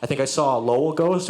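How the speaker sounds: ordinary delivery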